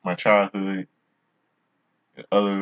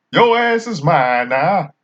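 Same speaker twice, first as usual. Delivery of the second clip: higher, louder, slow put-on voice; James Earl Jones impression; 9 semitones higher, 8 dB louder, slower than usual. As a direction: drawl the threat out in a hammed-up impression. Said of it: "It's my impression".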